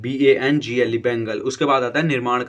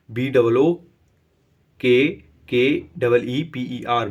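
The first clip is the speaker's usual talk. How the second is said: slow spelling; slower than usual. Shown as a demonstration. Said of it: "teacher spells out word".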